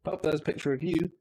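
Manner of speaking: usual speaking voice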